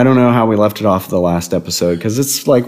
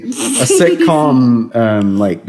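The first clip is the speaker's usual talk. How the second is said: normal talking voice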